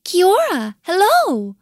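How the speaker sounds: high, loud little-bird hello; kiwis greeting back. A narrator's reading.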